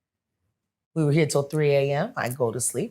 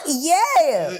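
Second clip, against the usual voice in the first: high burst of laughter into "Yeah"; laughing; 7 dB louder than usual. Real, not acted. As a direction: laugh out loud, then agree. Laughing at the beach story.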